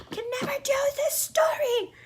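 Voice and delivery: very high, airy squeal of frustration; high-pitched exasperation. Real, not acted. Vlogger sidetracked from the story, high exasperated whine.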